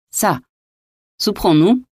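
high, rising, tart question; sour demand. A narrator's reading. Hungry guest interrupting his host's asides, mildly sour. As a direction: cut in with mild sourness. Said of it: "demanda aigrement Coconas".

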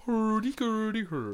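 slow, high mock Swedish babble; Swedish Chef voice. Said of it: "Who's the chef?".

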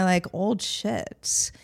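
whispered self-doubt. Retold or imitated. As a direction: drop to a breathy whisper on it. Self-doubting inner quote, whispered.

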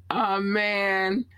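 ordinary talk, nothing marked

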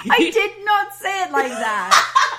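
high, loud, slow indignant denial; laughing protest. Real, not acted. Friend denying how she was mimicked, laughing indignant protest. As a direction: protest loudly through laughter.

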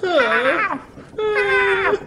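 piercing high animal squeal; sloth screech. Acted. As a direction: shriek like a silly sloth. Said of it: "your sloth impression".

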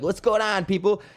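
a hint of a club DJ revving up the room; hype DJ host. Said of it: "they had a fucking D J M C".

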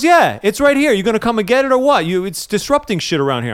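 a hint of a fed-up fire chief; impatient fire chief. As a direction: push him to come get it now. Annoyed fire chief on phone.

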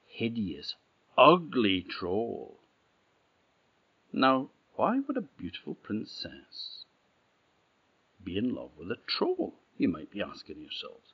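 usual speaking voice

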